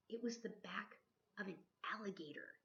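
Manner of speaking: usual speaking voice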